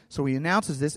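ordinary talk, nothing marked

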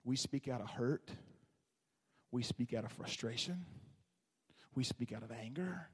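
quiet listing. Real, not acted. Preacher lists, voice drops low.

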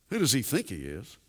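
quiet musing. Shown as a demonstration. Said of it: "quiet repeat of the question".

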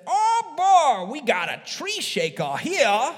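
high, loud Cajun hunter voice; Cajun impression. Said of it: "he said".

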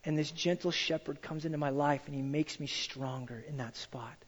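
soft, trailing into a whisper; hushed preacher fading out. Real, not acted. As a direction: let it die away to a breath. Tender sermon moment fading to whisper.

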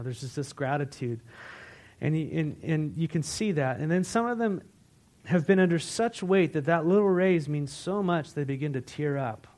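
usual speaking voice

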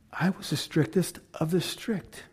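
quiet. Shown as a demonstration. Paul recalling his strictness to the crowd, hushed repeat. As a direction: say it again almost under the breath. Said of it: "repeated softer".

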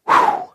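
high whistled exhale of relief; big puff of relief; relieved whew. Acted. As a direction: let out a high, airy "whew". Joshua relieved as water backs up.

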